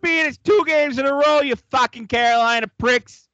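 put-on high, loud angry Boston fan with a garbled accent; irate sports fan hollering in a mangled East Coast accent; muddled Boston-Brooklyn accent. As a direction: yell it in a hammed-up Brooklynese accent. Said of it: "I don't know what accent that was".

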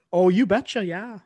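high, hammed-up Minnesota accent. Joking along about Fargo, exaggerated Minnesota accent. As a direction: answer in a playful Fargo-style Minnesota lilt. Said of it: "They don't actually talk like this".

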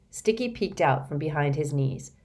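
ordinary delivery

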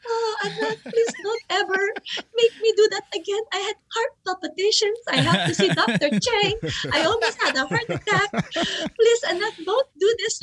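high-pitched, hammed-up tearful pleading; mock-distraught mother wailing like a soap star; melodramatic telenovela mom. Mom begging her daughter never to make her watch Muay Thai, melodramatic. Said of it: "like, on, like, a telenovela".

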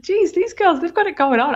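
high, laughing self-praise; impressed. Retold or imitated. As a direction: gush like a proud fan. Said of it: "I was like".

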